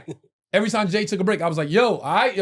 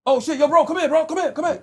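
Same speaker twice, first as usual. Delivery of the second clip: much higher, loud calling out; excited recognition; 10 semitones higher, 5 dB louder than usual. Retold or imitated. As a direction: yell him over like you spotted a star. Security dude spots celebrity.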